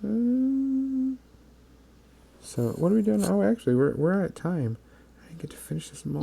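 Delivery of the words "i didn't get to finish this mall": whispering. Real, not acted. Aside while stream wraps up.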